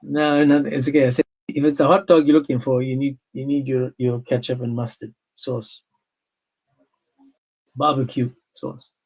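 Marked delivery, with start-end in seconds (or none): none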